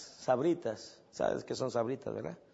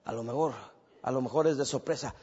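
usual speaking voice

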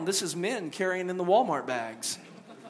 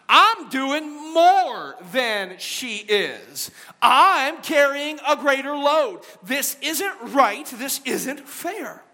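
louder, higher, slowing indignant protest; indignant complaint; 6 semitones higher, 5 dB louder, slower than usual. Acted. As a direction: protest it's unfair, drawing it out. Martha complaining about Mary.

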